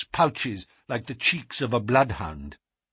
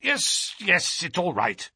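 jangled nerves; 5 semitones higher, slower than usual. A narrator's reading. Nervous patient accepts the visitors after scrutiny, jittery relief. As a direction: concede it, still jittery. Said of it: "his voice testified to his jangled".

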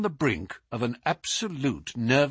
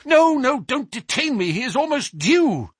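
higher, louder, panicked refusal to be held; a nervous man pleading to escape before he's caught; frightened protest; 11 semitones higher, 4 dB louder than usual. A narrator's reading. Nervous visitor begging Holmes not to keep him, frightened. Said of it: "With a frightened look".

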